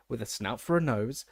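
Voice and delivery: plain reading voice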